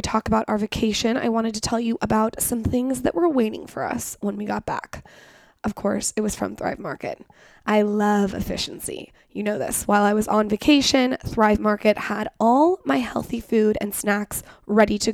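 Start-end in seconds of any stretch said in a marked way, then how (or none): none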